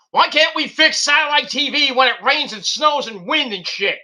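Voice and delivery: high loud frustrated outburst; exasperated rant. Real, not acted. Fed-up vlogger ranting about satellite TV in rain, loud. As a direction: vent at the broken satellite.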